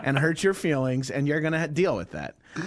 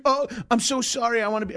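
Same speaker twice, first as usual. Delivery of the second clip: apologetic; 8 semitones higher, faster than usual. Retold or imitated. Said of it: "he was very apologetic".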